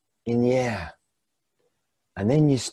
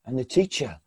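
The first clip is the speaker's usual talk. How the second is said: ordinary talk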